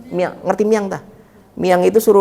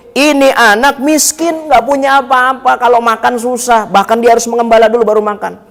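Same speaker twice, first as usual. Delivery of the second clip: raised, high-pitched storytelling quote; emphatic pleading speech; 5 semitones higher, 3 dB louder than usual. Retold or imitated. Abu Thalib telling Khadijah of the poor orphan, emphatic retold plea. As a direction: stretch the opening words, pitched high.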